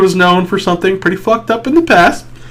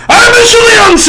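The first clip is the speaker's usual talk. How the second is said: high, drawn-out mock shout; aggressive German; 5 dB louder, slower than usual. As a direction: yell it like absurdly aggressive German. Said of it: "like, super aggressive all the time".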